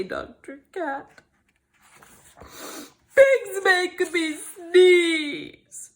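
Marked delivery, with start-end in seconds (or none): 2.4-5.9 slow, loud crying wail. Acted. Gerald wailing to Doctor Cat. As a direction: wail it out in tears.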